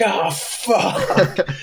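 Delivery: very high, comic panicked swear; mock horrified realisation. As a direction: squeal it as you spot the killer. Fireman sees Michael's mask.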